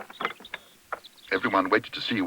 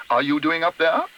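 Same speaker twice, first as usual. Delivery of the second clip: higher-pitched stern question; demanding; 6 semitones higher, 4 dB louder than usual. A narrator's reading. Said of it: "demanded Missus Bird".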